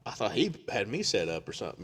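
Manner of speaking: ordinary talk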